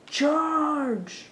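rally the troops, full voice; battle cry. Acted. Leading attack in game.

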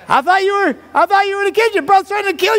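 high, frantic wife voice; high-pitched comic alarm; mock panic. Startled wife explains in a panic.

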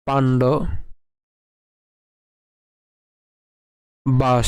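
পাণ্ড, long pause, then বাস ending on sh; broken-down pronunciation. Shown as a demonstration. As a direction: split পাণ্ড from বাস, each alone. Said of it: "এটিকে যদি আমি একটু ভেঙে দেই".

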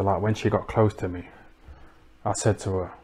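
ordinary talk

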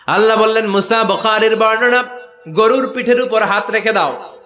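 high, loud sermon delivery; full-force preaching. Retold or imitated. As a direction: proclaim it high over the crowd.

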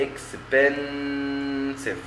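"expensive" very slow, each syllable held; syllables drawn out. Shown as a demonstration. Teacher rejoining the split syllables for students, very slow. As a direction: stretch "expensive" beat by beat. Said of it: "ممكن نقول إكس لوحدها".

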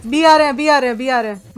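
loud, repeated alarm call; loud panicked game callout; urgent warning shout. Real, not acted. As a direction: shout the warning fast before they hit. Enemies rushing B, warning team.

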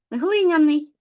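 глиняний with the first syllable ГЛИ stressed, high and loud; stress on the root. Shown as a demonstration. Teacher showing pupils root stress, ГЛИняний stressed on the first syllable. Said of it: "падає на корінь".

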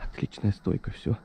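breathy hush, barely voiced; whispering. Real, not acted. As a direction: whisper so the bird won't flush. Whispering so the dog holds its point.

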